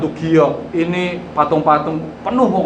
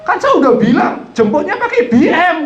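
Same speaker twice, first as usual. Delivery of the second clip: high, loud mother telling off; imitated angry scolding; 14 semitones higher, 5 dB louder than usual. Mother telling off the driver over the car, imitated angry scolding. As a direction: scold in a raised, high-pitched voice. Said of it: "Muarahin sama ibunya".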